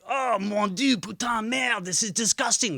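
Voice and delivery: high, loud put-on French accent, outraged; a disgusted French tourist, hammed up in a French accent; mock French accent. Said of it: "they sound exactly like the French".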